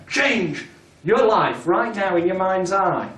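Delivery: slow, deliberate "Change your life right now"; embedded command. Shown as a demonstration. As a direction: mark the command out slowly. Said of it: "that other embedded commander".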